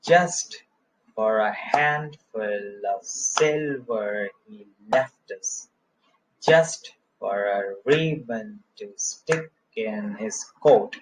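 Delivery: slow, rhythmic scansion reading with stressed beats hit; dactylic scansion. Shown as a demonstration. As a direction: read each foot slowly, punching just, handful, silver, left. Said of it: "तो just पे stress है". (just, handful, silver और left पर ज़ोर देकर धीमी लय में पढ़ना)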